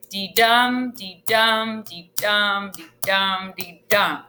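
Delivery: "dee dum" with the stress on every "dum"; iambic heartbeat rhythm. Shown as a demonstration. Teacher showing the class iambic pentameter, "dum" stressed in each beat. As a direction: tap out "Dee dum" as a steady heartbeat, weak then strong. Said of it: "tap it out with me".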